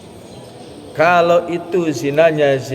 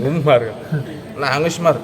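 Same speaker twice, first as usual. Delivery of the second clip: teasing laugh. Acted. Teasing that Umar cries.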